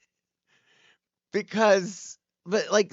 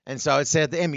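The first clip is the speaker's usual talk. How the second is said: ordinary talk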